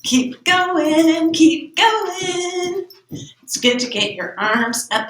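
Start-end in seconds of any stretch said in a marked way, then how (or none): 0.0-2.9 breathless sing-song while exercising; panting chant mid jumping jacks; out of breath. Real, not acted. Doing jumping jacks with class.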